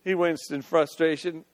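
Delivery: ordinary delivery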